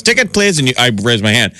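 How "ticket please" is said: high ticket collector call; ticket taker. Retold or imitated. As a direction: call out like an usher. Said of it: "he comes in and goes".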